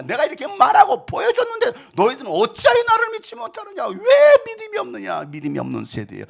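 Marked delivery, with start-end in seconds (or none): 0.0-5.2 very high, loud scolding; heated rebuke. Acted. Jesus rebuking faithless disciples, heated. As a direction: rail at them in reproach. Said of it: "왜 책망받습니까?".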